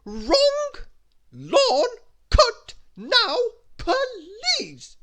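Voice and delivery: very high full yell, words drawn out; shrieked, slow bossy command; Little Miss Bossy. A narrator's reading. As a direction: yell the order word by word. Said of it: "ordered Little Miss Bossy".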